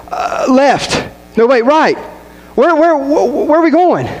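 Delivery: high-pitched, flustered mock panic. Acting out flustered directions on phone.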